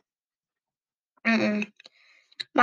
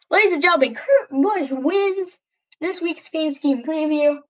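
announcer voice; 6 semitones higher than usual. Acted. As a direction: announce the winner like an arena host. Announcing the winner.

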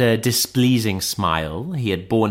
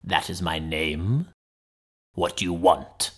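lightly voiced sinister Hyde, cool and low; coolly; 5 semitones lower, 4 dB quieter than usual. A narrator's reading. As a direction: answer coolly, keeping him at arm's length. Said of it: "he answered coolly enough".

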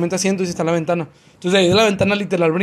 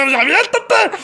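mock urgent yell; 13 semitones higher, 3 dB louder, much slower than usual. Yelling at the girl to jump.

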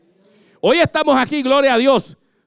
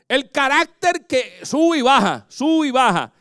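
preaching; 6 semitones higher than usual. Acted. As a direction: lift it up like a preacher. Preaching builds. (predicación elevada, voz aguda y lenta)

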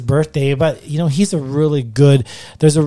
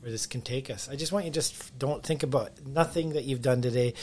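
guided meditation; 13 dB quieter than usual. Acted. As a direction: quietly lead them into calm. Leads a heart meditation.

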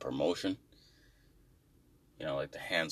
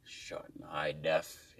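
whisper; 3 semitones lower, 7 dB quieter, slower than usual. Shown as a demonstration. Whispered mock tagline.

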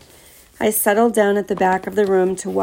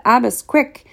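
higher-pitched, mildly urgent call; 5 semitones higher, slower than usual. A narrator's reading. Murat running into the lounge for Abbas, calling out. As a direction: call out to hurry someone along. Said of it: "ran into the lounge and called out".

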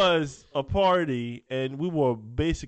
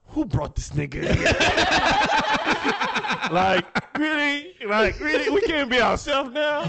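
high, laughing fake outrage; hammed-up disbelief, higher and laughing; mock indignation; 12 semitones higher, 4 dB louder than usual. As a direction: play up being put out at the party, half laughing. Retold indignant reaction at party.

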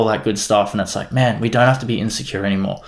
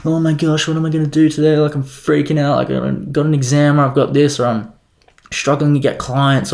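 mock panic; 5 semitones higher than usual. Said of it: "I didn't wake up being like".

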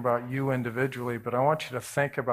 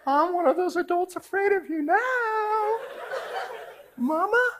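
mock fear. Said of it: "and I'm like".

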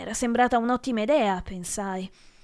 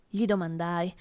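usual speaking voice

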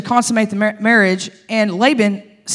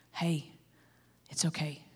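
a soft, low whisper of reassurance; tender comfort; 7 semitones lower, 19 dB quieter than usual. Retold or imitated. God comforts the unloved Leah in a tender whisper. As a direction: hush her gently, like holding someone who is crying. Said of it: "God looked at her and is like".

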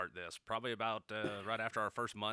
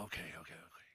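whispered; 6 semitones lower, 14 dB quieter, much faster than usual. Real, not acted. Sarcastic reply to web claim.

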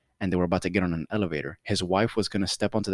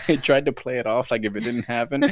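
normal talking voice

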